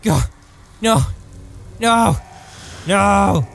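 hammed-up, drawn-out pleading; mock anguished cries. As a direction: cry out in pretend despair. Acting out grief over wife's death.

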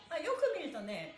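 ordinary talk, nothing marked

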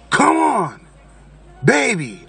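high, stretched imitation of a woman calling. Michelle calling him over to the car, imitated calling. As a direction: mimic an impatient call from the car.

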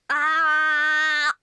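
loud open "Ah." for the doctor; saying ah. Shown as a demonstration. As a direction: open wide and say "Ah.". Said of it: "Can you show George how to say, "Ah"?".

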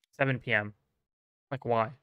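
ordinary talk, nothing marked

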